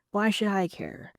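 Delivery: ordinary talk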